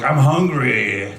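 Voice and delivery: slow, dopey hungry whine; mock a starving pizza customer; lightly voiced dopey hungry customer; impression of hungry customer. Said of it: "This is my impression of you".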